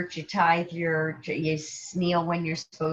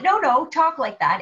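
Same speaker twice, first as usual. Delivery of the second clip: eager reassurance; 8 semitones higher, 6 dB louder than usual. Retold or imitated. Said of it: "And he said".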